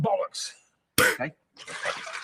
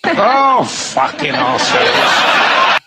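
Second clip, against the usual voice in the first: ordinary talk, nothing marked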